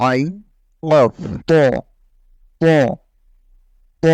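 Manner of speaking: ত with rounded lips, thick and full, not Bengali ত; full, thick Arabic ত; Arabic heavy ত demo. Shown as a demonstration. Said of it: "মুখটা গোল হয়ে আসে তথা মোটা হয়ে আসে".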